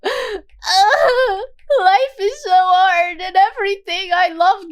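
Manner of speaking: put-on melodramatic sad girl, laid on thick; mock whining. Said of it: "just went".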